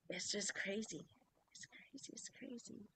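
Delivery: whispered, almost breathless; whispering. Real, not acted. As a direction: whisper it to yourself in awe. Quietly marvelling aside.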